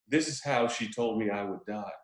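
low, hushed inner monologue; wistful reminiscing. Retold or imitated. Host voicing a doomed ninja's subtitled thoughts, hushed reminiscing. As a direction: drop low and soft, lost in memory. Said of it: "is just reminiscing about his girl".

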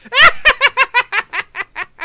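high, loud cackling witch laugh; witch's cackle. Acted. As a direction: cackle like a wicked old witch. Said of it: "like a full-on witch's cackle".

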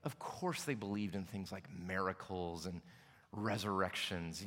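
condescending mock. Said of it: "we look at things like this and we say".

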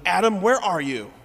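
loud, high accusing call; angry demand. Shown as a demonstration. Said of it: "maybe we hear it through the tone of".

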